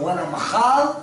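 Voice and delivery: can't wait for tomorrow, voice climbing; excited anticipation. Retold or imitated. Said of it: "הוא אומר".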